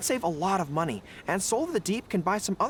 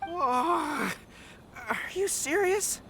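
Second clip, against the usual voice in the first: stunned; 7 semitones higher, much slower than usual. Acted. Stunned by the special edition.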